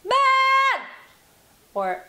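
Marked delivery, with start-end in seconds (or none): 0.0-0.9 long high loud yell on Man. Shown as a demonstration. Voice coach demonstrating her yell. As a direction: belt it out like you've lost it. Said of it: "like when I yell, I go".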